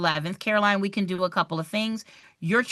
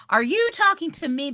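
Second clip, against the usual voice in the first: higher, pushing hard on "you"; retold indignant repeat; 8 semitones higher than usual. Teacher repeating her challenge to the student, leaning on "you". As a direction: lean on "you" to demand an answer. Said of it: "And I was like".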